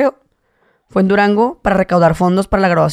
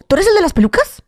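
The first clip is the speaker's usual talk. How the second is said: high, excited fan squeal; girl fan; 12 semitones higher than usual. Retold or imitated. Girl fan spotting him in the street, high squeal. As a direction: mimic a starstruck girl asking. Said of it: "la muchacha me dijo".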